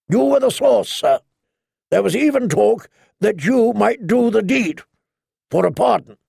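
a lightly voiced Sir Barristan, stern and accusing; old knight accusing. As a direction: lay out the charge like a witness.

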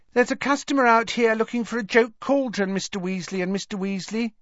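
high-pitched, polite staff voice; young witch shop assistant. A narrator's reading. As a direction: call the bosses to a customer in a high voice.

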